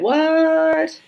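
high, drawn-out "what"; retold shocked reaction. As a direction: stretch the word out in high-pitched disbelief. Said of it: "And I went".

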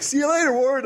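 put-on high child's voice; lightly voiced little kid saying goodbye; kid's voice. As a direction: chirp it like a small boy. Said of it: "he's like".

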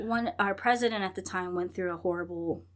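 usual speaking voice

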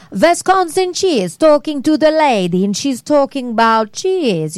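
slow, drawn-out Midwestern commercial voice; Wisconsin cheese lady. Retold or imitated. Actress showing panel where her character voice came from, slow Midwestern. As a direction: stretch the vowels like a cheese ad. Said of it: "I actually got that voice from the Wisconsin cheese commercial".

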